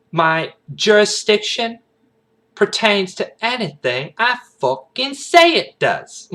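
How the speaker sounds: high, slow, loud Southern sass; Agent Bloomis. Acted. Agent asserting her jurisdiction to a detective, sassy Southern. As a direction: stretch the Southern vowels with attitude. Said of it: "what I'm saying with sassy".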